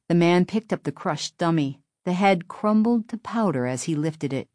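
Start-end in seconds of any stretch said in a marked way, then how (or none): none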